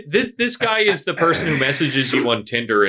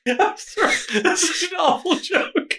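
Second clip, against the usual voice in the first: squeaky laughing apology. Real, not acted. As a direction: apologize through high laughter.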